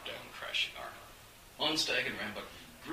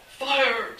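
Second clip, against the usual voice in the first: loud, higher alarmed call; 12 dB louder than usual. A narrator's reading. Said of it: "servants were calling".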